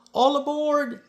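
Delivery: loud, high call of All aboard; cried out. A narrator's reading. Conductor calls the boy aboard, loud and high. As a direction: call it out down the platform. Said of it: "the conductor cried out".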